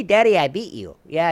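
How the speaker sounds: ordinary delivery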